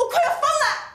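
high, loud cry of exasperation, half laughing; frantic outburst. Acted. Wife to husband's family, frantic high outburst. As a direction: at the end of her rope.

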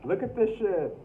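high, loud cringing cry; shocked outburst. Real, not acted. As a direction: recoil at what she reads. Cringing at her old diary.

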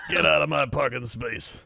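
lightly voiced grumpy troll; a hint of a territorial troll; parking-space troll. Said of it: "You'd be the troll".

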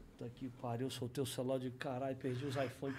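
low, quiet muttered cursing; hushed, low voice of defeat; retold dismay. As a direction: curse under your breath, sunk. Retold panic after insulting Neymar.

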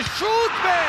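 sudden shout at a shot; excited shout. Real, not acted. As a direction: yell at the near miss. Near miss in live commentary. (heyecanlı bağırış)

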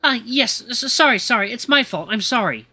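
stammering, flustered repeated sorries; a touch of nervous panic; trembling, scared apology. Acted. Scared little butler apologises to Eli, stammering nervously. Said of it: "the guy is trembling like crazy".

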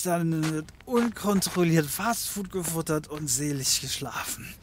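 tipsy detective. Acted. Drunk detective muttering to himself.

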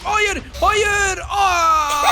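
high, loud panicked shouting; panicked cry. Pretend. Trapped player shouts at the trap, panicked. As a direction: shout in mock alarm, rising to a scream.

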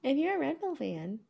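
high mocking echo of her catchphrase; mocking co-host. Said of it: "The number of times I have to hear you say that".

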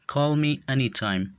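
each word said slowly and distinctly for learners; slow and clear. Shown as a demonstration. Teacher models English phrase for learners, slow and clear. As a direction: model the phrase slowly so students can copy it. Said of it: "به خاطر آموختن تلفظ درستشان".

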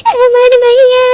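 high-pitched crying wail; hammed-up mother sobbing; mock wailing. Mother wails for missing daughter. (মেয়ের জন্য নাটুকে কান্না)

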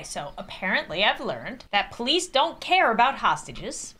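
usual speaking voice

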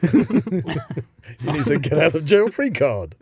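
loud, slow mock royal lecture; mock royal scolding. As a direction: lecture him slowly and loudly. Acting royal Monopoly game.

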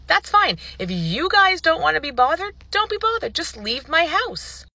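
high, agitated retort; heated, high-pitched telling-off; fed-up outburst. Retold or imitated. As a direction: snap back at officers in exasperation. Retold quarrel with the cops.

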